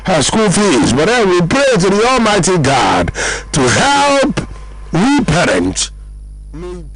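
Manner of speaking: loud, slow fervent prayer. Real, not acted. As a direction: call it out to the Almighty. Radio host praying loudly.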